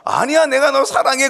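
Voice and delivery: high, loud pleading reply; Samson giving in. Acted. Samson answering his wife, high and pleading. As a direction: blurt it out, pitched high and loud.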